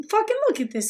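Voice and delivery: sudden high yelp of disbelief; shocked outburst. Real, not acted. Streamer reacting to a guest's outfit, shocked high yelp. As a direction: blurt it out, pitch jumping way up.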